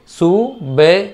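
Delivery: "సుబెహ్" split slowly into su, be, h; syllable by syllable. Shown as a demonstration. Teacher exposing the hidden h, "సుబెహ్" in slow syllables. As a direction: spell out "సుబెహ్" sound by sound with a separate breathy h. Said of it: "కానీ హా అని పలకరు".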